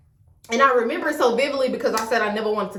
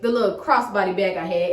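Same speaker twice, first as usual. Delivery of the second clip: normal talking voice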